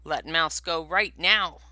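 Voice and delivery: loud, firm growled order; big bear's loud, stern warning; growling command. Acted. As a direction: bark it as a protective bear. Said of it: "stood up on his hind legs and growled".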